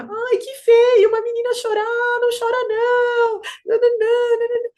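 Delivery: put-on very high, loud fussing at a child; mock baby-talk scolding, high and insistent; shrieky put-on tut-tutting at a toddler; imitated meddling stranger.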